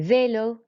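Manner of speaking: "vélo" with a lifted ay on the E; French vay-lo, accented first vowel. Shown as a demonstration. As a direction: raise the voice on the E of "vélo". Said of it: "we just raise our voice on the E".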